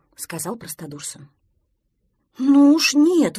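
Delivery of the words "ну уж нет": loud, drawn-out outraged protest; indignant. A narrator's reading. Duckling protests to Prostodursen, indignant. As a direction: object indignantly, high and loud. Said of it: "возмутился утёнок".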